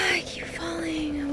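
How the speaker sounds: soft whispered complaint. Real, not acted. Gamer after falling again, dejected whisper. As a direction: breathe it out, defeated.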